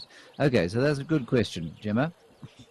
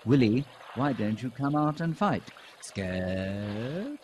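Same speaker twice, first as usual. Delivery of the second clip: greenbul bird-call imitation; 2 semitones higher, slower than usual. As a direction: chirp out the words like a bird calling. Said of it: "which apparently sounds like".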